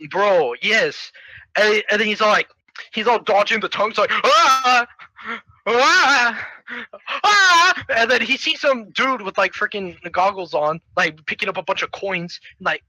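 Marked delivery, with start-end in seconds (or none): 4.1-7.8 three rising, loud yelps; mock yelps. Hero dodging frog tongues, mock panicked yelps. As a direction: yelp at each near miss.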